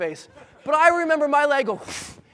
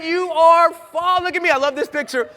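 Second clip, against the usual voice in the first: ordinary talk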